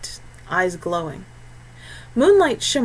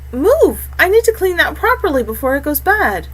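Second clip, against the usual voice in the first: urgent command; 9 semitones higher, 7 dB louder than usual. Acted. Kaveri urging the Amarog.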